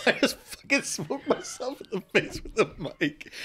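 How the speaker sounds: helpless high laugh through the words. Real, not acted. Host tells co-host about his mic mishap, laughing hard. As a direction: squeeze the admission out between laughs. Laughs after hitting himself.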